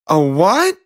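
high startled princess; a high shocked question; surprised princess. Acted.

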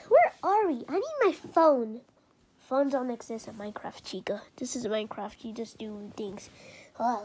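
ordinary delivery

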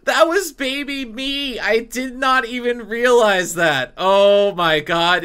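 stretched-out, horrified realization; dramatic dismay. Pretend. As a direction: lament it in overblown disbelief. Overblown shock at yelling at child self.

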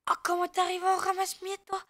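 high, loud protest; indignant outburst. Real, not acted. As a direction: squawk at the player who just turned up. Protests at a player arriving. (protestation criée, très aiguë)

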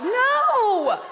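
a loud, high laughing "No!". Real, not acted. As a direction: burst out with a laughing refusal. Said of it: "laughing rejection".

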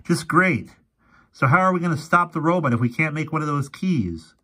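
a hint of a spooked young boy; boy Fergie.

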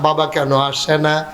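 normal talking voice

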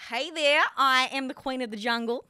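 loud, confident Australian accent. Shown as a demonstration. Guest copying the host's line on request, Australian accent. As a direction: belt it out in Australian. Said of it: "And Australian".

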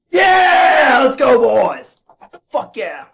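high, loud victory yell; real burst of elation; triumphant shout. Real, not acted. Celebrating a win.

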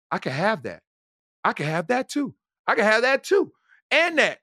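acted-out wonder mounting step by step; building retold excitement. Retold mounting realisation while shopping.

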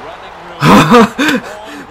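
loud, high burst of laughter; delighted laugh. Real, not acted. Streamer laughs after scoring a touchdown, high and delighted.

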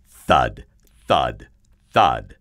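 low, loud, weighty beats with pauses; heavy giant footsteps. A narrator's reading. Narrator as the giant walks towards David, low heavy thuds. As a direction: drop each word like a huge foot.

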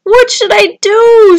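high, loud, drawn-out wail; storybook scream. A narrator's reading. Stranded Tudley asks the turtles, screaming. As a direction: cry it out slowly in a panic. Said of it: "screamed Tudley".

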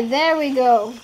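ordinary talk, nothing marked

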